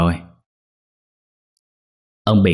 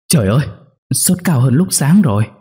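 higher-pitched worried outcry; alarmed exclamation; 6 semitones higher than usual. A narrator's reading. Wife finding her husband's fever worse, alarmed outcry. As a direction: cry out in dismay. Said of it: "bà kêu lên".

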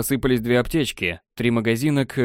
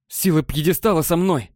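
high, exalted proclamation; fanatical fervour; 6 semitones higher than usual. A narrator's reading. Wide-eyed elf hailing the pedestal, fanatical. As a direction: declare it like a zealot, pitched up. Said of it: "широко раскрыв глаза".

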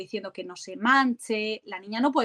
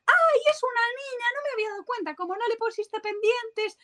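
much higher squealing exclamation of discovery; mock surprise; 12 semitones higher than usual. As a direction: act out a stranger suddenly realising it's a girl. (sorpresa exagerada, voz muy aguda)

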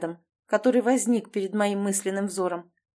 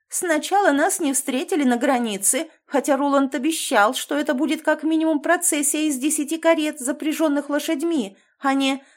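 mannered whiny voice; 6 semitones higher than usual. Acted. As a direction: complain like a spoiled hysterical noble. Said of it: "капризностью, истеричностью и властностью". (капризный манерный голос аристократа)

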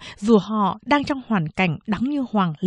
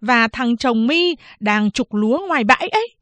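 higher, animated scolding mother-in-law; mẹ chồng đay nghiến; 6 semitones higher than usual. Acted. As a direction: lay into the daughter-in-law, pitch up. (giọng mẹ chồng cao, mắng nhiếc)

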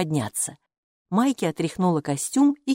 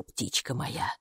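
whispered; 6 semitones lower, 11 dB quieter, slower than usual. Acted. Whispered endearment.

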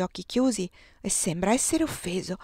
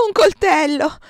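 terrified scream; 7 semitones higher, 10 dB louder than usual. A narrator's reading. Woman in labour screams at the doctor's blade. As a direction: scream it high and loud in panic.

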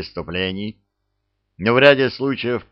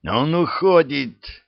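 barking shout; 7 semitones higher, slower than usual. A narrator's reading. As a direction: bellow it down the corridor. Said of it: "гаркнул капитан во весь голос".

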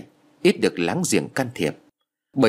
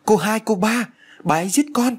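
much higher, frightened cry for help; 12 semitones higher than usual. A narrator's reading. Maid dodging the attacking mistress, calling for help in a high frightened cry. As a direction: scream for the young ladies while dodging. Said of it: "vừa né tránh vừa kêu cứu".

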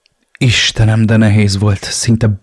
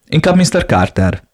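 higher, firm and stern reply; 8 semitones higher than usual. A narrator's reading. Lawyer answering a client's question, firm and stern. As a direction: state it firmly, no room for doubt. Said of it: "határozottan és szigorúan".

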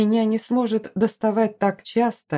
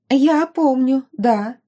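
excited chatter; 6 semitones higher, 5 dB louder, slower than usual. Acted. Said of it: "взбудораженно тараторила".

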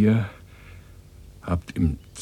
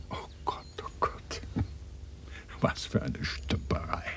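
exasperated whisper; 11 dB quieter than usual. Acted. Exasperated over the botched job.

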